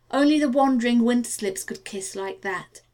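ordinary delivery, nothing marked

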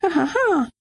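hohes, fremdes Lachen; hysterisches Lachen. Acted. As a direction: das Lachen nicht unterdrücken können. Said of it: "Ich muss nur immer lachen". (hohes, unfreiwilliges Lachen)

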